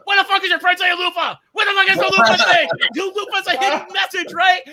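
high, loud imitated screech; mock enraged girlfriend. Madder girlfriend demanding loofah explanation, high mock fury. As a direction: yell it as the exasperated girlfriend. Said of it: "it just got her madder".

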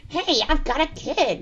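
slow, high, bad American accent; exaggerated American accent, stretched out; mock American accent. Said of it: "being all like".

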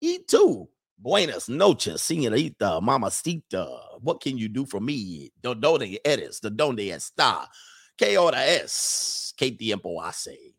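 slow, halting beginner Spanish phrases; clumsy tourist Spanish. Retold or imitated. Man flirting in Dominican Republic, slow tourist Spanish. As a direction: lay on a clumsy American-accented Spanish. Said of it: "He like".

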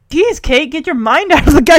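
a hammed-up put-down to her friend; mock scolding. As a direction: snap at her to stop. Voicing Jackie's scold.

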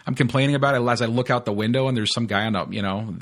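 normal talking voice

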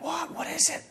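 soft, high-pitched whispery question; mock-secretive query; hushed curiosity. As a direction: ask under your breath, intrigued. Answering wife's secret call.

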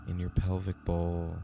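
calm, unhurried meditation guide; guided meditation. Acted. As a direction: breathe them gently into calm. Leads a meditation.

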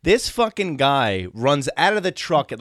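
ordinary delivery

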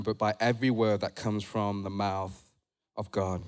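low, soft, measured reading voice; hushed Bible reading with pauses; scripture reading. Real, not acted. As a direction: read the verse out quietly and deliberately. Reads scripture aloud.

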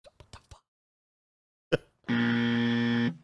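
barely audible whispered shut up; faint whisper. Retold or imitated. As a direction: fade it almost to nothing. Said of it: "Pretty Taurus boyfriend is like".

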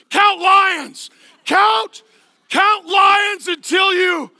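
high, booming shout; hammed-up shouted command; mock bedtime roar. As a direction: bellow a bedtime instruction like a lion. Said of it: "You don't say".

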